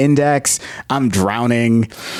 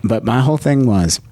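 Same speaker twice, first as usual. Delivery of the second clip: usual speaking voice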